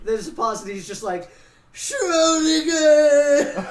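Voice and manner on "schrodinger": long, drawn-out high wail; mock anguished cry. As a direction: howl the name slowly and loudly, hammed-up. Retold guy cries out after pause.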